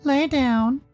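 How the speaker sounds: high, drawn-out instruction; high-voiced character. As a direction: pitch it up and tell him simply. Sal's voice, instructing Dave.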